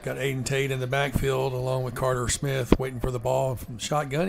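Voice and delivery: ordinary talk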